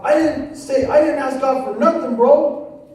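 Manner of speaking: shouting it back; said real loud. Retold or imitated. Defensive young man shouting back at the preacher. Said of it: "And I said real loud".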